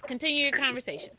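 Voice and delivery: ordinary delivery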